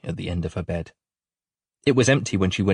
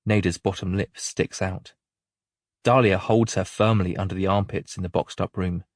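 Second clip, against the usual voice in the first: ordinary delivery, nothing marked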